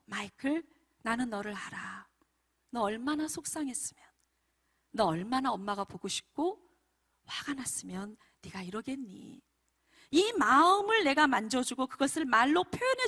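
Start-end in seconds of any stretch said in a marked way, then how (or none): 0.0-9.4 soft, tender empathy; acted soothing, understanding voice; warm words. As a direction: gently comfort an upset child. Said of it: "가장 따뜻한 말로".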